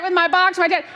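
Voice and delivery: ordinary talk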